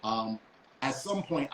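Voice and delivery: ordinary talk, nothing marked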